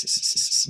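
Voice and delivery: sharp hissing s sounds; unvoiced s, s, s hissing; hissed S's. Shown as a demonstration. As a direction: hiss out a string of S's. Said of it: "my S's are now very loud".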